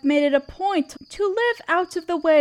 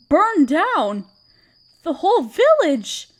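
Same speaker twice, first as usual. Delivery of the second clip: shocked dismay; 3 semitones higher, 5 dB louder than usual. Acted. Guard reports the burned village.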